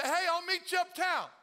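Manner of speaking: a high, loud call across town. Retold or imitated. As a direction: shout the invite to a friend. Calling out to a friend.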